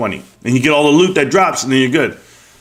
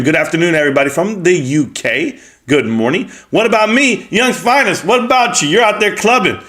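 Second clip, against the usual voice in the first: ordinary talk, nothing marked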